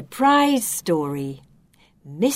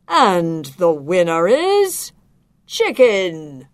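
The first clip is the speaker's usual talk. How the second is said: slow, louder judge's reveal; grand announcement; 8 dB louder, slower than usual. Acted. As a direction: build up to the winner's name. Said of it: "announced the inspector".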